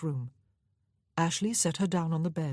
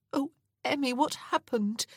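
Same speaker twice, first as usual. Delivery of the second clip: distressed sister; 9 semitones higher than usual. Acted.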